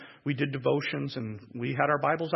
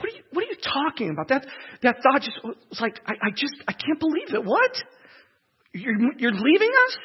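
put-on high, stammering cry of a hurt child; stunned disbelief; 10 semitones higher, 5 dB louder than usual. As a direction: stumble over the words as the shock hits. Retells disbelief at father leaving.

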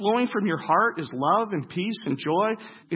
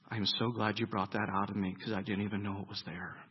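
soft grateful prayer; 10 semitones lower, 9 dB quieter than usual. Pretend. Voicing a grateful believer.

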